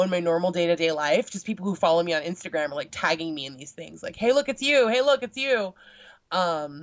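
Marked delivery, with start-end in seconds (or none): 4.2-5.7 higher, louder mock call-out; imitated excited followers. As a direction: point someone out with playful excitement. Acting out followers tagging her.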